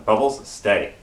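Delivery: low, measured order to a dog; retold dog command, kept low and restrained; retold calm command. Owner telling his dog to stay at the door, calm command.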